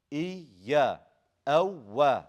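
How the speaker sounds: "إيا" and "أو" punched on the doubled y and w; nabr. Shown as a demonstration. Teacher shows the two letters of nabr, emphatic. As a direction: push hard into the y and w, separately. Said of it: "what two letters we do that with, the nabr".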